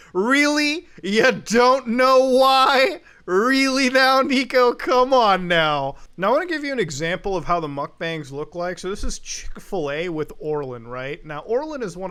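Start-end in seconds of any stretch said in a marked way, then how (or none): 0.1-6.0 loud, slow, high disbelief; incredulous sarcasm. Real, not acted. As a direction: drag out the sarcastic really. Incredulous jab at Nico.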